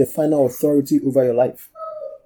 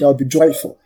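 ordinary talk

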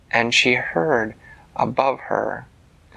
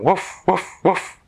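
playful woof woof, pitched higher; read-aloud dogs barking after the goose; dog barks; 8 semitones higher than usual. A narrator's reading. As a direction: bark like a cheerful farm dog. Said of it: "the dogs going".

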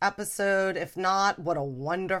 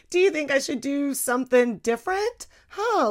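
ordinary delivery

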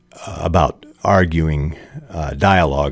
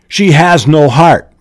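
appealing mother; 9 semitones higher, 6 dB louder than usual. Acted. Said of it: "Mom appeals to Dayton".

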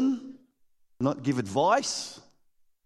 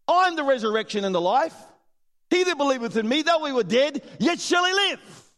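higher, louder grand proclamation; proclaiming Jesus; 9 semitones higher, 4 dB louder than usual. Retold or imitated. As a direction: announce it with solemn authority. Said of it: "he responds to Martha with".

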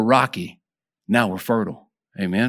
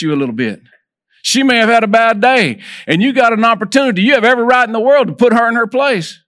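rising preacher's cry, higher and louder toward the end; fervent preaching; 7 semitones higher, 4 dB louder than usual. Acted. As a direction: build the sermon to a climax. Preaching builds to peak.

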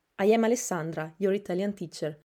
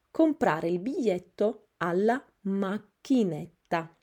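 ordinary talk

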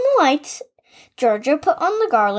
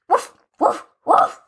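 barked woofs, a bit higher; dog barking; 4 semitones higher, 3 dB louder than usual. A narrator's reading. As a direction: bark it out like a dog. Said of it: "the dog barked even louder".

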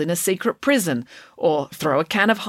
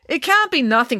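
higher, louder insistent protest; 9 semitones higher, 5 dB louder than usual. Acted. Animesh pressing Finn for the truth, insistent. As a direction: push back, won't let it drop.

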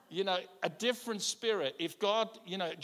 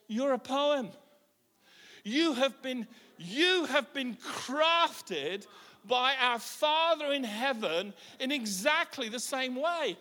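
affirming declaration; 6 semitones higher, 5 dB louder, slower than usual. Pretend. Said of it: "look at the person next to you and say".

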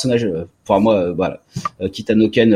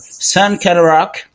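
"Sunken Rock" drawn out slowly in a higher, louder mock American accent, like /sankan rak/; 6 semitones higher, 5 dB louder, much slower than usual. Host jokingly flaunting his Harvard accent, mock American accent. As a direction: say "Sunken Rock" like a show-off American, slow and raised. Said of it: "je reprends mon accent".

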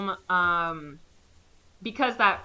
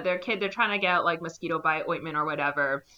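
ordinary delivery

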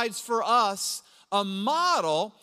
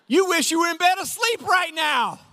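calling it out; 8 semitones higher, 4 dB louder than usual. Pretend. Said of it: "It's like".

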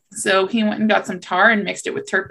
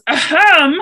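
loud, high exaggerated ahem; pointed throat-clearing; 8 semitones higher, 7 dB louder than usual. Rabbit thinks tar baby is deaf, pointed loud ahem. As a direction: demand attention with a spelled-out ahem. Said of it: "thinking that tar baby is deaf".

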